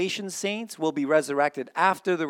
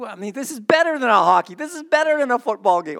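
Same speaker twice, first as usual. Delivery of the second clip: higher, louder, insisting; excited; 9 semitones higher, 8 dB louder, faster than usual. Real, not acted. Preacher comparing heaven to sports, excited. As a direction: sell it like it beats any game. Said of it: "I'm getting so excited".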